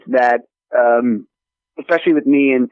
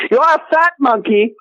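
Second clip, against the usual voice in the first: mock insult; 11 semitones higher than usual. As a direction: tease the monkey. Calling monkey fat.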